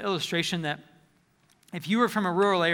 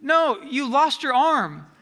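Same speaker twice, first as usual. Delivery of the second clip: higher, slow, exasperated retort; incredulous King Arthur; 6 semitones higher, 5 dB louder, much slower than usual. Retold or imitated. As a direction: spell out the obvious to him, slowly. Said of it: "And King Arthur says".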